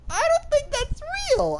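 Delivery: squeaky falsetto disbelief; comic protest way up in falsetto; high-pitched denial. Acted. Prisoner disputing the CCTV footage, in squeaky denial.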